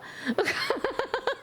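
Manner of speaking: a bright burst of laughter; high laugh. Real, not acted. Laughs at the viewer.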